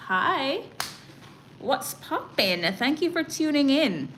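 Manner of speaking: loud excited greeting. Real, not acted. Welcoming a viewer who joins the live, excited greeting. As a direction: greet the newcomer with a burst of energy.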